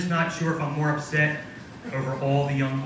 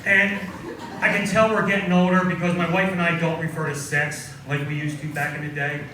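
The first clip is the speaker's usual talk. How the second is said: ordinary talk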